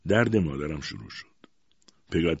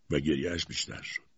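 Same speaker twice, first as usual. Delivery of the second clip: ordinary delivery, nothing marked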